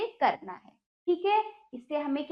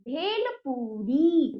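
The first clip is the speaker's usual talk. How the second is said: slow, drawn-out answer; much slower than usual. Shown as a demonstration. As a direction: stretch भेल पूरी out slowly. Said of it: "फूल भेरी कुछ नहीं होता है".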